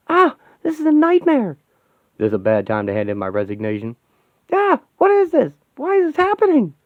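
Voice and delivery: a panicked hero who can't believe this; panicked high character voice. Acted. A hero panics as the robots attack, high and frantic.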